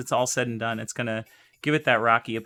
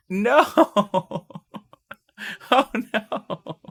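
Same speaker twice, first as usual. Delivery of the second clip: high, loud laughing "no"; real laughing cry of no; laughing dismay; 10 semitones higher, 6 dB louder, much slower than usual. Real, not acted. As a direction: laugh through the shock. Laughs at her own misspelling.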